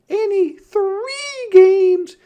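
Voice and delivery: very high, loud and slow, sly little sister; hard bargain. A narrator's reading. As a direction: drag it out, savouring the deal. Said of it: "driving a hard bargain".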